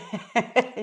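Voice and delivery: a quick sheepish giggle; short embarrassed laugh. Real, not acted. Guest laughing at herself for talking too much, short giggle. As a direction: laugh it off lightly.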